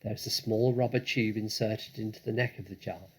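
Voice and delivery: ordinary delivery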